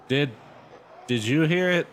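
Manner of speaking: frightened stammer. A narrator's reading. Chris asking her sister about the voice, stammering. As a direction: stumble on the first word. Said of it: "Chris stammered".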